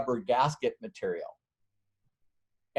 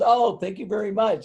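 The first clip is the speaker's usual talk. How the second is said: higher, flattered secretary voice; mock-polite gatekeeper thanking him, higher; 8 semitones higher, 4 dB louder than usual. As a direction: play the pleased secretary, pitched up. Secretary voiced in retold story.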